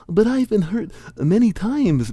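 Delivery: high-pitched, acted protest. Young hero contradicting the magician, protesting. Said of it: "Bink protested".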